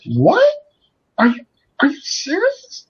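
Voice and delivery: high-pitched imitation of disbelief; rising, incredulous mock shock; shocked caller. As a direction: act out a woman's stunned question. Caller shocked at his demand.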